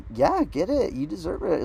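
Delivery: high-pitched, over-supportive cheer; mock encouragement. Man cheering on his date's drink order, mock encouragement. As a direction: hype up your date's pricey order.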